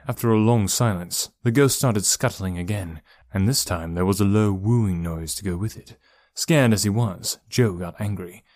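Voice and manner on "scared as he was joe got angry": ordinary delivery, nothing marked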